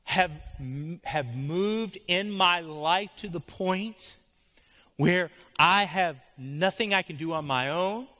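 impassioned spoken prayer; fervent prayer. Acted. Prays aloud at full force.

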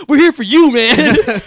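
laughing through the words; cracking up while reassuring. Real, not acted. Laughing reply to the review.

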